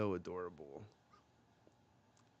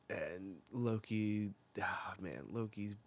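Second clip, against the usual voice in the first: normal talking voice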